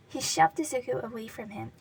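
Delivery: ordinary delivery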